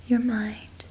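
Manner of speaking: usual speaking voice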